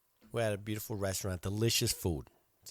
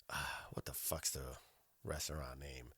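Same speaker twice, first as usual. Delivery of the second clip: ordinary talk, nothing marked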